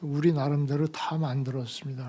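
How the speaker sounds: ordinary talk, nothing marked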